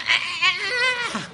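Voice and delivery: high, loud frazzled cry; imitating the shrieking car, high and loud; mock chaos yell. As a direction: let the madhouse burst out of you. Mimicking the car madhouse.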